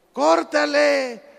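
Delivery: high, slow, drawn-out order; loud acted command; shouted command. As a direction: call the order down from above. God's order shouted to climber.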